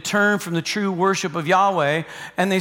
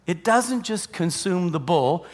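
ordinary talk, nothing marked

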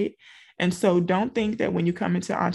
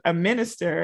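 ordinary talk

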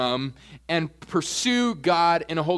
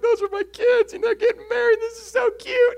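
much higher, gushing like a proud dad; teary proud dad; 5 dB louder than usual. Retold or imitated. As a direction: squeak it out like a dad about to cry. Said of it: "I felt like a dad. I was, like, tearing up".

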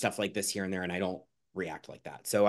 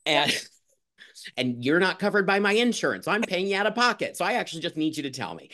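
exasperated retort; 7 semitones higher than usual. Retold or imitated.

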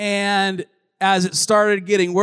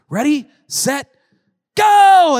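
high, loud ride countdown, building to a shouted go; hyped-up countdown; 12 semitones higher, 11 dB louder, slower than usual. Retold or imitated. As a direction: launch the ride with a drawn-out holler. Dad starting a pretend ride.

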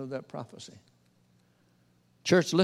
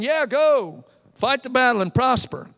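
yes-men cheer; 7 semitones higher, 3 dB louder than usual. Acted. Yes-men prophets cheering the king on.

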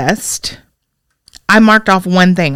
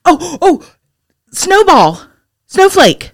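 excited; 7 semitones higher, much slower than usual. Real, not acted. Excited naming idea.

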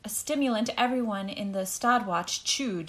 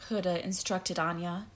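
plain reading voice

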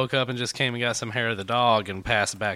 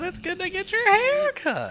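put-on much higher drunk voice; imitating drunk man. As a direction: parrot the drunk's line high. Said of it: "Buddy got his hair cut".

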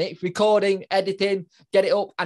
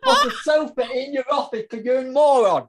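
laughing self-scolding; 6 semitones higher than usual. Pretend. Mock scolding himself, laughing.

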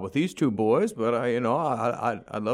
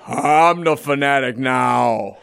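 possessed maniac; 12 semitones higher, 7 dB louder, much slower than usual. Acted. Father possessed by mascot.

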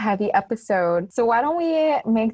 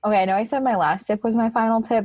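ordinary talk, nothing marked

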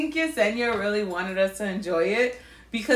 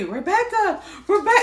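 calling out; 9 semitones higher than usual. Retold or imitated. Friend calling her name.

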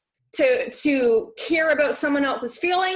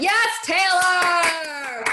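excited cheer; 3 semitones higher, much slower than usual. Real, not acted. Viewer comment she wanted.

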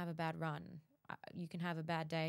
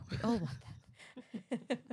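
mock sympathy; 4 semitones higher, much slower than usual.

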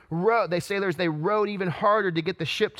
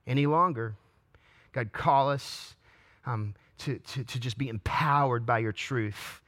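earnest prayer; 5 semitones lower than usual. Real, not acted. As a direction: pray over the congregation, low and sincere. Pastor prays.

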